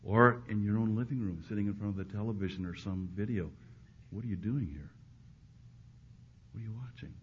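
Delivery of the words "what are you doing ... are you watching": hushed, probing questions; gentle inner voice. Acted. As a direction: softly prod the conscience. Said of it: "you might hear that voice".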